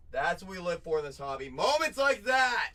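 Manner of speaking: swelling into a hype cry; building excitement. Real, not acted. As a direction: build up and peak on the last line. Builds to a hobby hype peak.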